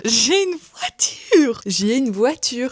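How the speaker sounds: drawn-out, high, gleeful squeal; excited announcement. Real, not acted. Vlogger tells viewers her big news, excited and drawn out. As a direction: stretch it out high with delight.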